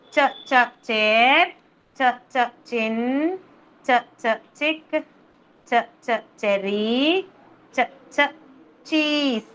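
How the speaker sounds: rhythmic "Ch, ch" then the word, as a phonics drill; first sounds isolated. Shown as a demonstration. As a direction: double short "Ch" before each word from "chair" to "cheese". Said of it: "beginning with the ch sound".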